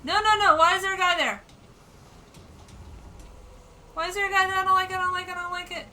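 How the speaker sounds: high, loud, near-crying panic; frantic, scared to bits; terrified panic. Real, not acted. Ambushed by an enemy.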